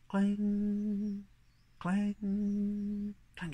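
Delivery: high, sustained ringing clang; church bell clang. Acted. Storyteller imitating church bells at midnight, ringing clang. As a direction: hold each clang on one ringing note.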